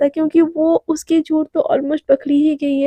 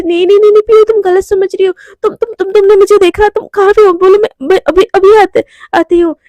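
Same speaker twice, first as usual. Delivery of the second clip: higher and louder, rushing denial; panicked pleading; 5 semitones higher, 5 dB louder than usual. Acted. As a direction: desperately stop her hanging up. (घबराहट में ऊँची, तेज़ आवाज़ में मनाते हुए)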